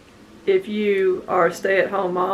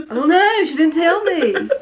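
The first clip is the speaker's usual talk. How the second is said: exasperated; 8 semitones higher than usual. Retold or imitated. Exasperated reply about crying baby.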